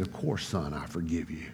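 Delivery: very soft, low reassurance; almost hushed, gently absolving; tender forgiveness. Retold or imitated. Father forgiving his weeping son, tender and hushed.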